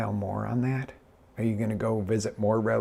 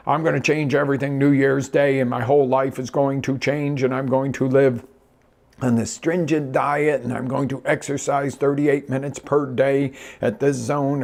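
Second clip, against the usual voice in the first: louder, higher, hammed-up earnest declaration; mock resolution zeal; 4 semitones higher, 5 dB louder than usual. Coach imitates someone vowing a New Year's overhaul, mock zealous. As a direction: reel off grand vows with mock determination.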